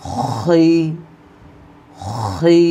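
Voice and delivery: খই thick, from the back of the throat; heavy khi. Shown as a demonstration. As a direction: give খই a full, heavy mouth. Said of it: "মোটা করে উচ্চারণ করতে হবে".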